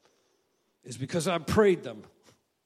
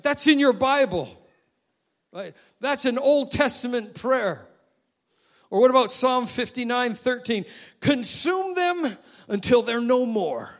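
loud, high, driving sermon delivery; full-force preaching; 8 semitones higher, 7 dB louder than usual. Acted. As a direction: preach it hard to the congregation.

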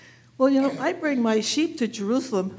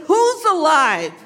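urgent question; 6 semitones higher, 9 dB louder, slower than usual. Acted. Shepherd stops runner to ask.

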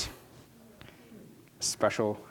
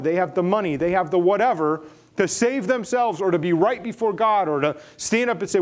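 louder and higher, driving each they have home; preaching at full force; 7 semitones higher, 6 dB louder than usual. Acted. Pastor thundering to the congregation about the proud, at full force. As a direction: preach it out, building with every line.

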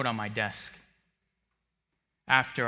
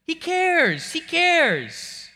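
much higher, loud insistence; passionate emphasis; 6 dB louder, much slower than usual. Acted. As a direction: cry out that he cares. Emphatic repetition.

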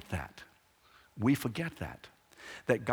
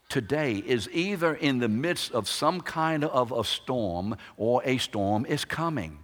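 ordinary delivery

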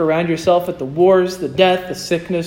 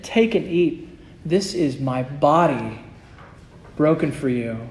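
lower, softer, slow and gentle; hushed tender words at the table; tender offering; 4 semitones lower, 7 dB quieter than usual. Retold or imitated. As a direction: hand them the bread tenderly. Christ offering bread at supper.

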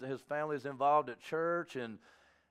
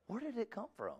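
normal talking voice

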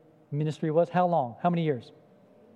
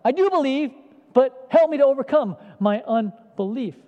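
loud, higher pleading cry; desperate cry; 6 semitones higher, 6 dB louder than usual. Retold or imitated. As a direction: plead with all your heart. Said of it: "It's like".

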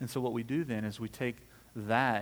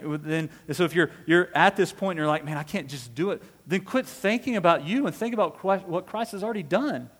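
raised, higher, insistent preaching; 6 semitones higher, 8 dB louder than usual. Real, not acted. Preacher urging the congregation to think about Christ, forceful preaching. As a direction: press the exhortation hard on the listeners.

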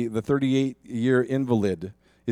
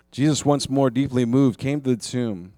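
normal talking voice

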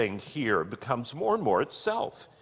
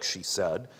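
ordinary talk, nothing marked

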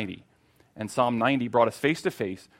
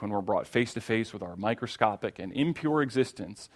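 normal talking voice

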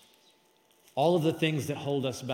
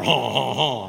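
loud, hammed-up French "haw haw haw"; mock French; 3 semitones lower, 7 dB louder, slower than usual. Preacher adding it to a hamburger order, mock French laugh. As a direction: tack on a silly fake French laugh. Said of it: "I'm speaking French".

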